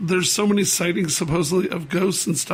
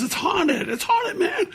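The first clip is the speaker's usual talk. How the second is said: excited retold warning; 12 semitones higher, much slower than usual. Uber driver telling passenger the building is haunted, mock alarmed. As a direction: pitch leaping up, mock alarm.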